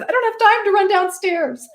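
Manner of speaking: high-pitched mock protest; retold protest. Woman retells refusing to fetch brighter lipstick, high mock protest. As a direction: pitch it way up, imitating your own complaint.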